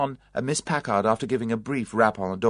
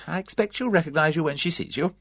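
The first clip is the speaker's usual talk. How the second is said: cheerful; 8 semitones higher than usual. A narrator's reading. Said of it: "went on cheerfully".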